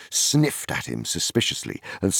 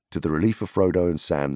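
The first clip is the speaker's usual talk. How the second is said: plain reading voice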